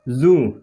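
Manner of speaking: जू with a buzzing z as in zoo; जू with a vibrating z sound. Shown as a demonstration. Teacher showing the right way, जू said with a buzzing z. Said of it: "इसको हम कहते हैं जू".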